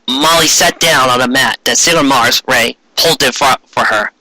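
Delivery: plain reading voice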